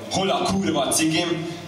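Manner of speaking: irritated demand. Acted. Poet voicing someone hunting for their cigarettes, irritated demand.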